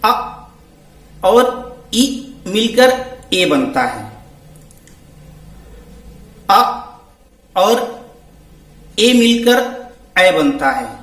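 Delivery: slow, deliberate sounding out; slow demonstration. Shown as a demonstration. Teacher slowly showing how vowels merge. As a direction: spell the blend out slowly for students. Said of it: "कैसे हैं आइए देखिए".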